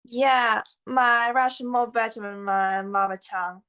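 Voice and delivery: ordinary delivery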